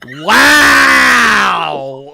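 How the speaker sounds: loud drawn-out impressed wow; stunned wow. Real, not acted. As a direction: let the wow stretch out in disbelief. Reacting to a super chat.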